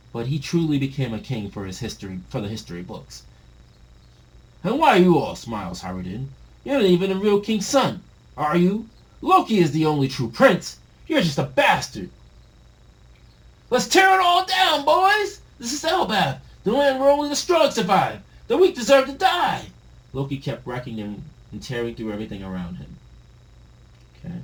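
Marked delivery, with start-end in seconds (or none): 4.6-12.1 mocking, louder, higher bully voice; bully taunt. Bully prince taunting a half-brother, mocking. 13.7-19.7 much higher, loud brute rallying; savage war cry. Acted. Loki urging the boys to wreck it all, savage shout.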